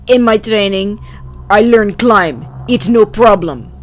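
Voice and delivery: put-on matter-of-fact soldier in a thick Russian accent. Camo stranger explaining his training, Russian accent. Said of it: "In a thick Russian accent".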